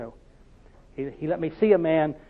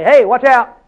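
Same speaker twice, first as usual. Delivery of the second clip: warning call; 6 semitones higher, 9 dB louder, slower than usual. Pretend. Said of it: "I could jump in there and say".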